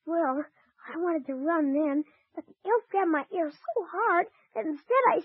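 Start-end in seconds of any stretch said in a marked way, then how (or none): none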